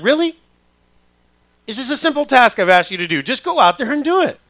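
high, loud, incredulous parental complaint; exasperated parent. Acted. Dad to kids who won't do a simple task, exasperated. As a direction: plead in exasperation at a child dodging a chore.

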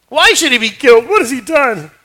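pleading son. Acted. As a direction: beg your father to explain. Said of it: "Jonathan said to his father Saul".

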